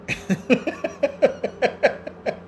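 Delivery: long wheezing laughing fit; helpless laughter. Real, not acted. Host remembering a video, cracking up helplessly. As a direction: crack up until out of air.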